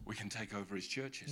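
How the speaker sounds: low, hushed aside; acted scheming. Preacher as Paul's jealous rivals, quietly scheming. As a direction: drop the voice as if plotting.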